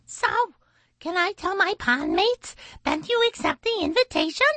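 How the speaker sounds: very high, fast bird squawk; high-pitched, rapid blue jay chatter. A narrator's reading. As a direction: squawk it quickly like an eager bird. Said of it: "the blue jay squawked".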